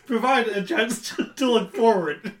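high, loud, barely holding back laughter; laughing. Real, not acted. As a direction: try to talk through giggles. Guest keeps breaking into laughter.

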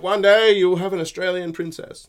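loud, high royal proclamation; grand king voice. Retold or imitated. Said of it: "he's like".

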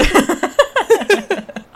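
high giggle. Real, not acted. Player laughs after the joke.

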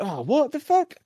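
high shocked swear; mock outrage; exasperated. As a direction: squeak it in disbelief. Poppy exasperated.

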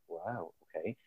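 soft, hushed mock amazement; stunned wow. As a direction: let it drop to a stunned murmur. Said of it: "we're like".

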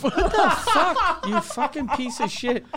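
high, slow, laughing mock fury; hammed-up angry rant at a one-link tweet; mock outrage. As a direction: act out raging at a lazy tweet. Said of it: "And I was like".

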